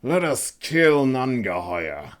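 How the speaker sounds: grudging agreement grunted through gritted teeth; grunted through clenched teeth. A narrator's reading. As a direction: force the words out through a fake smile. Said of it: "he grunted, through the clenched teeth of a forced smile".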